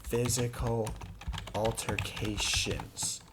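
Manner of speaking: annoyed emphasis. Real, not acted. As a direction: stretch each syllable out in irritation. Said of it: "it makes me mad".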